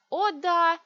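loud, high mocking outburst; near-hysterical bitter agreement; hysterical sarcasm. A narrator's reading. (истеричный сарказм)

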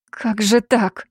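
plain reading voice

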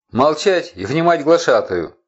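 loud, high angry roar; furious bellow. A narrator's reading. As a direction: bellow the order down. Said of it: "яростно грянуло сверху".

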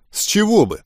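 defensive retort. A narrator's reading. Original retort, later mimicked. (возражая, повышенным тоном)

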